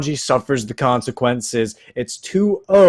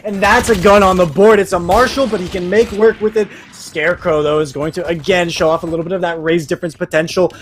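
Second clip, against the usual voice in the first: esports caster; 3 semitones higher, 4 dB louder than usual. Acted. Live play-by-play casting.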